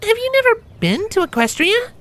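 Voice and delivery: put-on very high young griffin voice, surprised; friendly griffin puzzled at her friend; squeaky-high, amazed; Gabby, surprised griffin.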